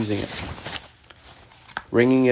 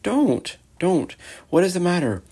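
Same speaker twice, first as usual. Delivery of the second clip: high-pitched, anxious pleading; greatly distressed; 8 semitones higher than usual. A narrator's reading. Girl begging the sobbing faun to stop, distressed. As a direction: plead with a high, falling cry. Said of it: "said Lucy in great distress".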